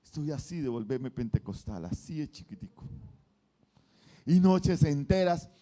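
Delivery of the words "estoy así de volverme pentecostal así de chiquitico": hushed aside. Acted. Narrating his near conversion.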